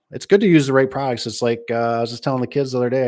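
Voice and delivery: normal talking voice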